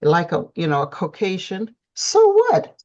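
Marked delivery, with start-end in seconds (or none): 1.9-2.8 high, loud "who cares"; emphatic, high-pitched shrug-off; defiant dismissal. Real, not acted. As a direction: wave the objection away, voice jumping up. Dismisses objections to mixed couples.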